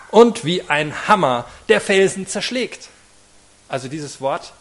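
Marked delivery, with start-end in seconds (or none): 0.1-2.9 forceful scripture reading. Acted. Preacher reads Bible verse emphatically.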